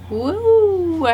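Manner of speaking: loud, high whoop; real loud whoop of delight; excited whoop. Real, not acted. Hyped after the punchline.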